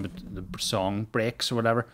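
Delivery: usual speaking voice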